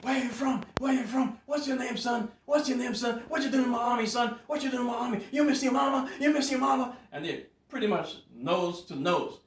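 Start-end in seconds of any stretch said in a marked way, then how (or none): 0.0-7.0 high, loud drill-instructor yell; screaming drill sergeant. Retold or imitated. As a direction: bellow like a furious drill sergeant at recruits. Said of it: "and they're like".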